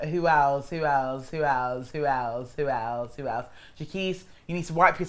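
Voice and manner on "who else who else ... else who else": absent-minded repeating. Real, not acted. Vlogger trying to remember who is left, fading repetitive muse. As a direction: fade down as you search your memory.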